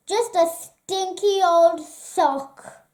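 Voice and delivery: usual speaking voice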